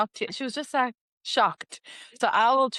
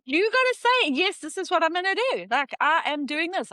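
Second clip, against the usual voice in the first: high-pitched, insistent retold affirmation; animated quote of self-encouragement; emphatic pep talk; 7 semitones higher than usual. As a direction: urge it on with punched words.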